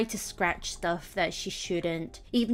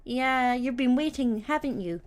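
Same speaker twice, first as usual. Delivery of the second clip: put-on higher, louder cooing baby talk; cooing to cat; 5 semitones higher, 4 dB louder than usual. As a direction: coo at a cat who waited for you. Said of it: "you're like".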